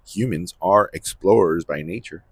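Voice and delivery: ordinary talk, nothing marked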